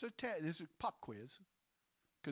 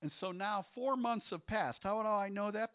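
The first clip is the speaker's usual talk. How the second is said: ordinary talk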